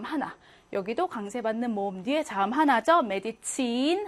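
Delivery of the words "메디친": 친 in 메디친 held long; long vowel. Shown as a demonstration. Teacher reading a German example, long i in 메디친. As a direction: stretch the last vowel of 메디친. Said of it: "길게 발음하고요".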